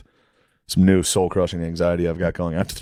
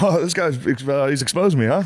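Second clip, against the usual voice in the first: higher, caught-out sheepish voice; sheepish busted friend; 7 semitones higher than usual. Retold or imitated. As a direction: play the guy who got exposed. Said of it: "he was like".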